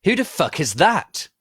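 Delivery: loud, high, angry question; angry demand. A narrator's reading. Heroine angrily demands who the stranger is, loud. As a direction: bark it at the stranger. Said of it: "I asked, angrily".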